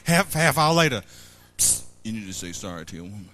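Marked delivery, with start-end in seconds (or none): 1.6-3.3 low, fast, hushed whisper; God's quiet nudge, whispered fast. Acted. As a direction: nudge him quietly and quickly. God whispering again.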